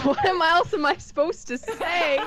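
slow, laughing protest. Real, not acted. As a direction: giggle through the question. Laughing retort.

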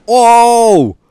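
sudden loud startled yell; shocked shout. Real, not acted. Shocked by hammer hit.

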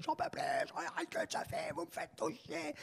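high, breathy, strained imitation of a yelling actress; mock furious outburst. Actress telling off the harsh director, imitated high and breathless. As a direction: squeeze it out high as if screaming at the director. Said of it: "elle l'engueule".

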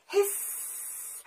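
long unvoiced snake hiss. Shown as a demonstration. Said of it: "let me hear you".

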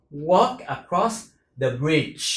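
normal talking voice

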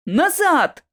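short, high-pitched warning shout; urgent command. A narrator's reading. Lockwood pushing the narrator out of danger, urgent. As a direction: order them back at once. Lockwood shoves her back.

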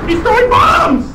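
high loud warning yell; alarmed shout. Real, not acted. Warning teammates that the enemy throws bombs, loud alarmed yell. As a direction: shout the warning across the map.

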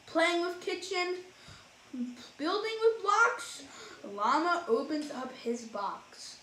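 lively, sing-song storybook reading; reading to children. A narrator's reading. Reading a rhyming picture book to kids, animated. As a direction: read it like bedtime for little ones.

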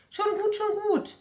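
very high, loud mouse calming the frog; soothing mouse. Acted. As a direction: squeak it out to settle him. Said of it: "beruhigt die Maus".

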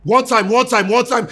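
loud, high, hammered repetition; angry mimicking. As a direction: throw the catchphrase back in exasperation. Mocks friend's catchphrase in anger.

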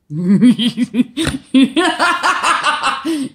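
high, loud cackling laugh; uncontrolled loud laughter; big laugh. Real, not acted. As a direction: burst into a long rising belly laugh. Laughs at near mishap.

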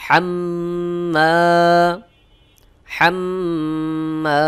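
হাম্মা with the doubled ম held long and nasal; nasal ghunna hold. Shown as a demonstration. Teacher showing students the ghunna in হাম্মা. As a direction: stretch the ম of হাম্মা slowly with ghunna. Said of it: "ওয়াজিব গুন্না হবে".

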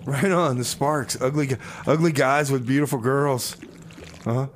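ordinary talk

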